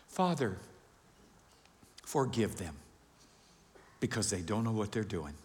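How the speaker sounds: low, soft, forgiving plea; quiet prayer. Acted. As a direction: pray gently, with long pauses. Acts Jesus praying forgiveness on cross.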